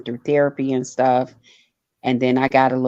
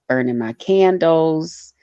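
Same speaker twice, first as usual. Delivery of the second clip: ordinary talk, nothing marked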